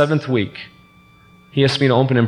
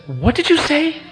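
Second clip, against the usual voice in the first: high-pitched, disbelieving question; incredulous reaction. Retold or imitated. Husband retelling his shock at the counselor, incredulous. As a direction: ask it high, in mock disbelief.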